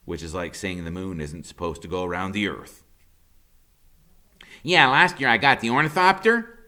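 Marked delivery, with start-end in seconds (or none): 4.6-6.6 put-on high, loud wisecracking boy; Freak's high smart-aleck voice. A narrator's reading. Boy joking about his own birthday, high and cocky. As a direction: crack the joke like a cocky kid. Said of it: "he says".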